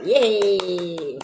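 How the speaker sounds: normal talking voice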